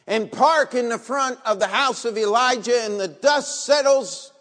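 loud preaching at full force. Acted. Preacher describing the general's arrival, loud preaching.

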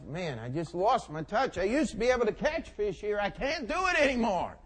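exasperated complaint rising in pitch and volume; acted frustration. Peter grumbles to himself over empty nets, increasingly frustrated. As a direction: build to a high, frustrated wail of defeat. Peter laments his lost touch.

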